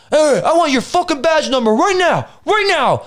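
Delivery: high, insistent retold outburst; mock indignant demand. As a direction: imitate a bystander demanding answers from police. Retold bystander confronting the officer.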